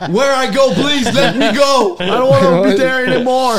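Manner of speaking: hammed-up plea to get out of town; mock pleading player. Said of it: "une réaction que tous les joueurs qui quittent Calgary disent".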